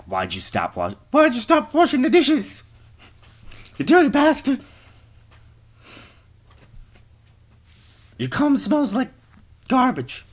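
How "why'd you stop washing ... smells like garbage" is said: very high, loud nagging screech; nagging wife yell. Acted. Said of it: "his wife is like".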